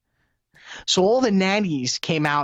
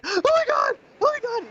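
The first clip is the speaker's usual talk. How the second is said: high mock-panicked cry; imitating a panicked kid. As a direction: squeal it like a boy about to fall off the ride. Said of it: "He's screaming and he's crying".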